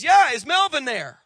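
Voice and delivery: loud, high repeat phone caller; insistent caller. Acted. Dad calls the stranger again.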